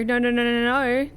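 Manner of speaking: ordinary talk, nothing marked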